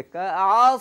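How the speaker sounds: কাস with a very thick স; very heavy sad. Shown as a demonstration. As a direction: fatten the সদ in কাস fully. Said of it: "সদ অনেক মোটা হবে".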